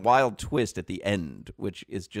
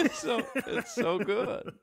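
laughing; 11 semitones higher, 7 dB quieter, slower than usual. Real, not acted. Laughing after the impression.

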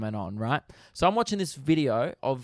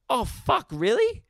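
mock clueless self; 9 semitones higher, 4 dB louder than usual. Mocking what the officer expected, high and daft. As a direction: play an idiot who forgot where he lives. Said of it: "As if I was gonna be like".

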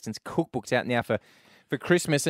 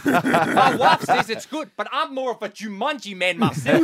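put-on South African accent; 8 semitones higher, 4 dB louder than usual. Video store customer on films, high South African accent. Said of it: "That's all I heard for eight years".

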